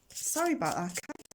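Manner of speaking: normal talking voice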